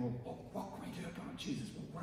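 mildly hushed, hurried plotting; hushed whispers. Retold or imitated. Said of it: "all kind of hushed whispers saying".

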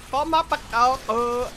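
high sing-song fake Vietnamese, lightly done; a joking stab at sounding Vietnamese; mock Vietnamese. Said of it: "I don't even know if that's what they sound like".

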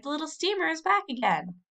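high, joyful call of the news; excited cry. A narrator's reading. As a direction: cry it out, thrilled the boat is back. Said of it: "everybody cried".

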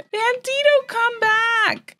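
very high sing-song call after the cat. Real, not acted. Player calling her cat back in a high sing-song voice.

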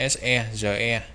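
ordinary talk, nothing marked

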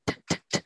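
đ as a small voiceless release; soft final consonant. Shown as a demonstration. Teacher showing the word's final sound, đ very softly. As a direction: tap out đ softly, barely any voice. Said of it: "đọc nhỏ thôi".

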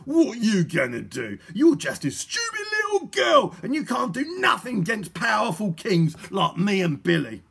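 loud villain's taunt, leaping high; sneering. A narrator's reading. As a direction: shout down the little girl with contempt. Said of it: "sneered Mister Gum".

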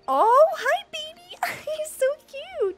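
high delighted coo. Real, not acted. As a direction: coo at the cute creature. Cute Pokemon appears.

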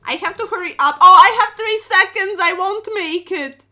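loud, high, panicked cry; last-second panic. Real, not acted. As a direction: cry out as time runs out. Three seconds left on clock.

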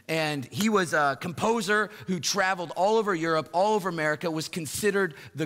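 loud, high storytelling from the pulpit; full-force preaching. Acted. Pastor telling the congregation about Ole Bull, loud and high. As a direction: drive the story home to the crowd.

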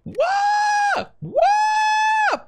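very high, loud howling yell; wild wolf-like yelp; real howl. Real, not acted. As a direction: howl out the frustration. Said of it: "Tengo unas ganas de gritar".

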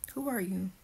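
usual speaking voice

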